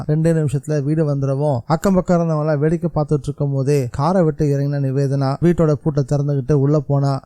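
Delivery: plain reading voice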